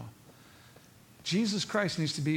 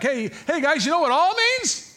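loud, high, bursting with news; acted-out eager shout; excited announcement; 12 semitones higher, 7 dB louder than usual. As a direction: call the guys over with a big discovery. Acting Peter bringing back the news.

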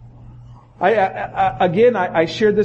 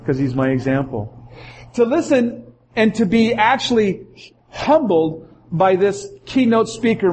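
ordinary delivery

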